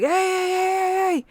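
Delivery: high, loud repeated hey; referee calling out. Retold or imitated. Overseer yelling at shooters to stop, loud calls. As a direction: stop the game, call out hard.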